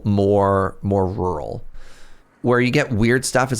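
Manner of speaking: normal talking voice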